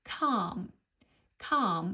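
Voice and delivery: calm said with a long open British ah and a silent L; pronunciation model. Shown as a demonstration. Teacher modelling calm for learners, long British ah, silent L. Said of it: "يا ريت تعيدوها".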